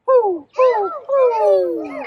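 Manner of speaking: high, loud owl hoots. Pretend. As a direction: hoot like an owl. Owl sound for kids.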